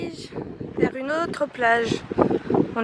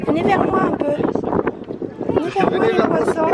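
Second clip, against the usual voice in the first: calling the fish; 7 semitones higher than usual. Real, not acted. Calling fish to come closer.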